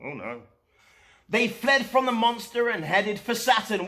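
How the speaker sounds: ordinary delivery, nothing marked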